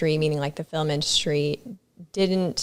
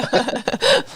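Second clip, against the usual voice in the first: laugh; 6 semitones higher than usual. Real, not acted. Laughs at her own accent.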